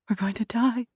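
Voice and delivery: ordinary delivery, nothing marked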